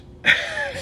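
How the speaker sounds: quick burst of high laughter; sharp high laugh. Real, not acted. Host recalling being shut down, bursting laugh. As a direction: laugh out at the memory.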